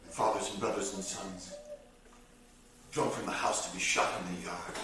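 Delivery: low and very soft; hushed grim telling. Acted. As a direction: sink the voice for the grim news. Grim fact of the killings.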